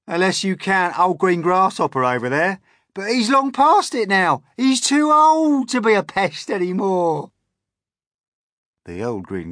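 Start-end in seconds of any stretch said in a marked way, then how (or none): 0.1-7.3 high, loud, brash bug voice; cheeky Centipede. A narrator's reading. Centipede teases the old grasshopper, cheeky. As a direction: needle the old one loudly.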